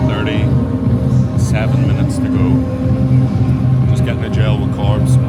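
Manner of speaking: loud over music. Real, not acted. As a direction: talk up over the warm-up music. Pre-race talk over loud music.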